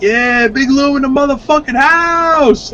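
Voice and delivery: high, slow, drawn-out hype yell; yelling it loud. Retold or imitated. Skating past the neighbors, yelling a slow, high hype call. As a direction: shout it out like a hype man. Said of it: "Like, yelling it loud".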